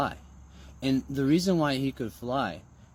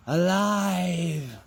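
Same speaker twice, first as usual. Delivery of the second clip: exclaim alive; 2 semitones higher than usual. Pretend. Zombie comes alive.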